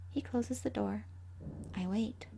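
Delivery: ordinary delivery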